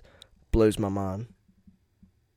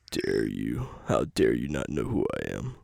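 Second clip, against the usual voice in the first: low, soft mock outrage; 9 semitones lower, 6 dB quieter than usual. Pretending to be [name] offended at not being known, low. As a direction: act hurt, deep and quiet. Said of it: "acting offended as [name]".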